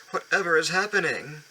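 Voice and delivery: high startled question; alarmed trucks. Acted. Trucks cry out when jerked, high alarmed voice. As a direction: squeal it out in alarm. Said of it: "screamed the trucks".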